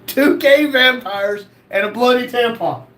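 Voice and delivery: slow, amused disbelief; disbelieving reread. Real, not acted. Host rereads a viewer's wild username, disbelieving. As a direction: read it again like you can't believe it.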